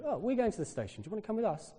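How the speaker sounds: high-pitched, friendly offer; lightly voiced Italian locals. Italians invite a lost traveller along, lightly imitated high voice. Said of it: "In Italian".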